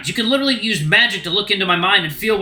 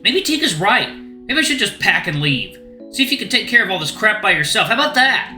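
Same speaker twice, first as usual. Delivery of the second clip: raised, bitter and hurt; angry rant; 4 semitones higher than usual. Acted. Luke to Rune about Teak's bullying, raised and bitter. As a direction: pour out every grievance at once.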